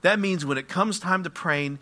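ordinary talk

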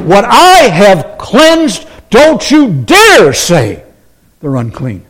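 slow, stern warning; God rebuking Peter. Retold or imitated. Preacher voices God warning Peter, slow and stern. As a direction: lay down the law, slowly. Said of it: "God says to him".

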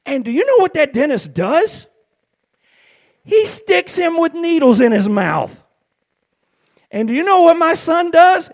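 high, loud pulpit rhetorical build; worked-up preacher, pitched high; full-force preaching. Acted. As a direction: preach it loudly, drawing out the key words.

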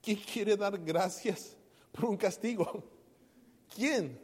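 slow, loud and pointed; rhetorical challenge. Acted. Preacher daring the congregation, slow and loud. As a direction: drag out the question to provoke them.

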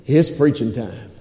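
low, hushed aside; quiet, deep, knowing remark; sly aside. Acted. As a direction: drop it low, as if to yourself. Peter's sly aside to himself.

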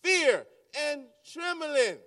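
fear and trembling punched high and loud; stressed. Real, not acted. Preacher to church, stressing fear and trembling. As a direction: hammer home the words. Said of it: "we must do it with fear and trembling".

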